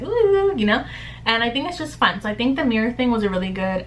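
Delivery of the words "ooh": sassy ooh. Retold or imitated. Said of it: "I'd be like".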